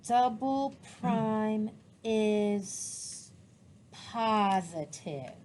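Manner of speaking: very slow, with long gaps; writing aloud. Real, not acted. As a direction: stretch the words to match the pen. Teacher writing her sentence aloud.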